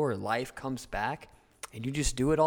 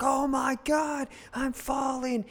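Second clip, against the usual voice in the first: very high, slow falling scream; 13 semitones higher than usual. Retold or imitated. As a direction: shriek it as you drop from the plane. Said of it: "you're probably screaming".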